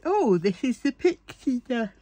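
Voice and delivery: words pulled long through a real yawn. Real, not acted. Tired viewer yawns through the pixie dust words. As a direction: talk while yawning, tired and sleepy. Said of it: "Sorry, I'm yawning again".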